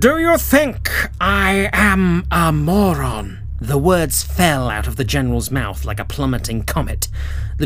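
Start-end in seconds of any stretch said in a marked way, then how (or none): 0.0-3.4 slow, cold menace; a villain barely holding his rage; menacing general. Acted. Furious general to lieutenant.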